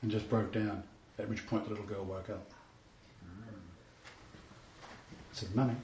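choked up, dropping low and very soft; real teary. Real, not acted. Pastor overcome telling of a revived little girl, teary. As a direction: let the voice sink away as tears well up. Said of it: "Forgive me, I get a bit teary".

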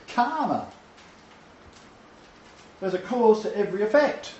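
ordinary talk

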